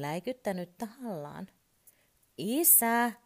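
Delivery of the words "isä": high, loud call for dad; child calling out. A narrator's reading. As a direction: shout for dad like a little boy. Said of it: "hän huutaa taas".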